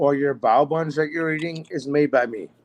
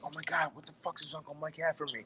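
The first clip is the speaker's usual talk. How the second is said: excited inner thought; 3 semitones higher, 15 dB quieter, faster than usual. Retold or imitated. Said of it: "I'm like".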